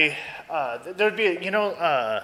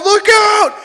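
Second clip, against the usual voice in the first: loud, high yell; shouted warning; 11 dB louder than usual. Retold or imitated. Snowboarder warning the girl in front, urgent shout. Retold warning before near collision.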